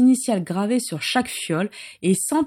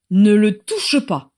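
louder, commanding; firm order; 5 dB louder than usual. Acted. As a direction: stop him with an order. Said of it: "ordonna Rémus".

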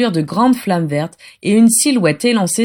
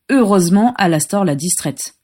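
usual speaking voice

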